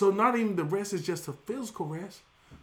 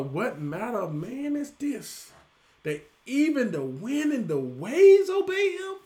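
awed disciples; 4 semitones higher, 5 dB louder, slower than usual. Retold or imitated. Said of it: "they all in all".

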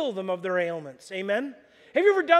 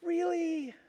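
higher, amazed disbelief; incredulous Nana; 7 semitones higher, 7 dB quieter, slower than usual. Retold or imitated. Nana hears about preaching online, higher and amazed. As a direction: Nana can't believe what she hears. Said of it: "she's like".

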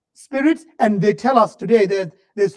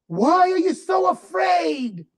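higher, slow shouted question; 6 semitones higher, slower than usual. Shown as a demonstration. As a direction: press the question harder. Repeated with contrast.

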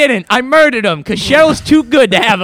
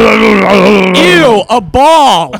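disgusted shout; 5 dB louder, much slower than usual. Acted. [name] recoils from the ball.

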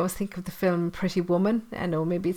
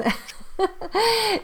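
high bursting laugh; burst of laughter; 14 semitones higher than usual. Real, not acted. Laughs at own accent.

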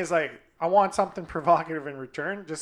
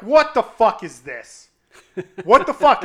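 loud, slow, disbelieving shout building higher; retold angry outburst; 4 semitones higher, 10 dB louder than usual. Telling a friend about the girl's screenshots, angry shout. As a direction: yell it like you just found the screenshots. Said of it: "And I'm like".

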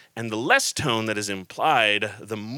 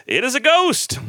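terrified cry; 7 dB louder than usual. Acted. Disciples terrified, reading quote.

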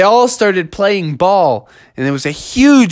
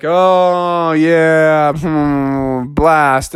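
lower, drawn-out grumble; disappointed guests; 4 semitones lower, much slower than usual. Acted. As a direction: groan in let-down resignation. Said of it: "they're all like".

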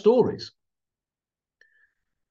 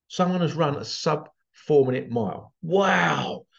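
usual speaking voice

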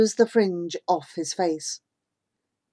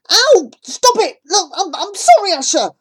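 pained yelp; 12 semitones higher, 11 dB louder than usual. Acted. Punched boy yelps and apologises.

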